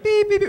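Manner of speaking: high, loud beeping; imitated smoke alarm. Smoke alarm going off as you hear it, loud high beeps. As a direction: beep shrilly like a smoke alarm.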